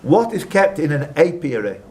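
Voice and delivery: ordinary delivery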